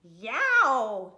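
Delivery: very loud storybook yelp; pained yowl. A narrator's reading. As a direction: yowl as if bitten. Turtle bites pig's tail.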